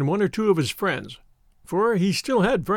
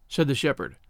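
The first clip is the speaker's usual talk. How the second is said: plain reading voice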